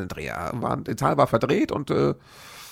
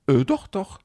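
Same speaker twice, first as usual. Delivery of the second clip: higher and louder insistent doch, doch; mock insistent clerk; 8 semitones higher, 5 dB louder, slower than usual. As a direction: press the doch, doch like a sure official. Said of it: "sagt er zu mir". (beharrlicher Sachbearbeiter, höher und lauter)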